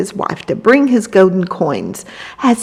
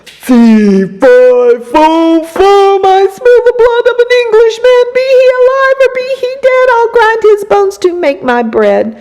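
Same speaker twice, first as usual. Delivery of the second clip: giant roar, much higher, louder and fast; thundering giant; 12 semitones higher, 4 dB louder than usual. A narrator's reading. Giant sniffing the air thunders at Jack. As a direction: boom the chant out like a giant. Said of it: "and he thundered".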